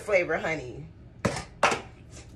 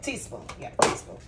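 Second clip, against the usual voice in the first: whispered aside; 8 dB quieter than usual. Real, not acted. Muttering a correction to herself.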